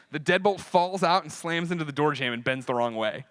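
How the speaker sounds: usual speaking voice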